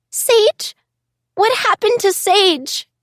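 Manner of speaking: put-on high, shrill frightened child; a scared girl crying out for her friend; shrill and terrified. Bonnie asking the teacher about Sage, shrill and terrified. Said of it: "shrill and terrified".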